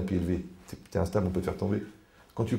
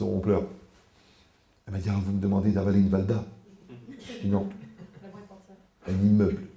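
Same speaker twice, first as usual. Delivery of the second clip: ordinary talk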